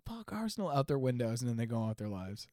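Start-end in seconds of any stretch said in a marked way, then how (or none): 0.1-0.7 high, soft pretend yell; mock yell. Co-host teasing Spurs fans yelling out their windows, muted mock yell. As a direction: imitate a yell at reduced volume, pitched way up. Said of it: "yell, like".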